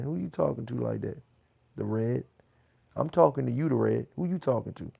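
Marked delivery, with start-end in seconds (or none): 3.0-4.1 loud, pointed comeback; angry retort. Real, not acted. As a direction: bark it back at him loudly. Confronting chatter The Red.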